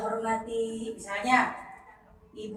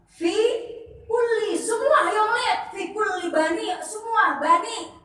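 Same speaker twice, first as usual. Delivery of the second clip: excited explaining; 8 semitones higher, 7 dB louder than usual. Real, not acted.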